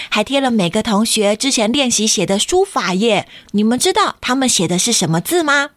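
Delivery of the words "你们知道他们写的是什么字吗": plain reading voice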